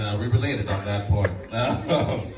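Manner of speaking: ordinary talk, nothing marked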